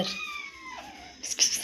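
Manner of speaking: unvoiced pss-pss to draw a kitten; coaxing kitten call sound; pss-pss cat call. Real, not acted. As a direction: hiss a quick pss pss pss.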